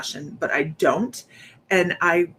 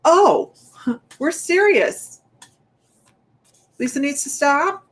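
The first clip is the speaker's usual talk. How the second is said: startled realization; 8 semitones higher, 5 dB louder, slower than usual. Retold or imitated. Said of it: "I went".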